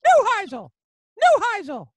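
Joe Paterno calling out. Retold or imitated. Old coach summoning a young coach from poolside, loud high bellow. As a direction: yell the name like a gruff old coach summoning you. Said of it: "he goes".

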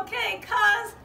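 high, excited lamb voice; a small eager lamb looking for family; little sheep. Acted. Little sheep searching for her cousin, high and eager.

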